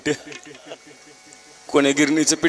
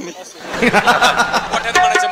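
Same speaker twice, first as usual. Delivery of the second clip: laughing coax; 3 semitones higher, much slower than usual. Real, not acted. Coaxing baby, laughing.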